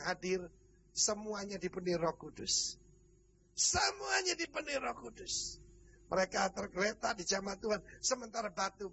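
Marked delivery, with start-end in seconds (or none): 3.5-5.6 high exclamation, sliding down through the phrase; emphatic proclamation. Real, not acted. Preacher stressing that all four hundred were filled, emphatic proclamation. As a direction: repeat it as a ringing, high-pitched proclamation.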